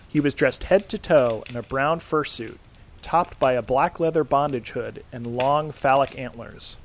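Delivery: ordinary delivery, nothing marked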